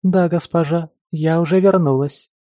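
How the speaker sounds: high, lilting maid voice; melodic singsong. Acted. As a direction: sing the words out sweetly. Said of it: "пропела незнакомка мелодичным голосом".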